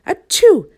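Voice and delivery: loud high sneeze breaking in; sneeze mid-sentence. Acted. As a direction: sneeze through the line. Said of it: "Mister Sneeze".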